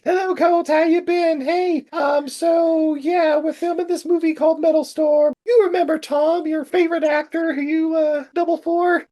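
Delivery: very high-pitched phone-call voice; producer Gail. Retold or imitated. Producer phones the stuntman about a movie, high-pitched. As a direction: put on a high, chirping caller voice. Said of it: "a call from producer Gail".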